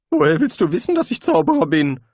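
high, loud desperate protest; loud desperate cry. A narrator's reading. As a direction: plead in panic, voice jumping up. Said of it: "erwiderte Rincewind verzweifelt".

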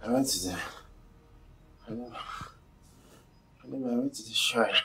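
low, soft, hesitant admission; guilty confession. Acted. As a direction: mumble it out ashamed. Confessing reluctantly.

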